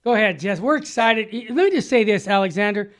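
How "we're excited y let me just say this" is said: usual speaking voice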